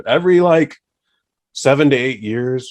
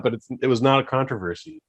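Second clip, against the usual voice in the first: usual speaking voice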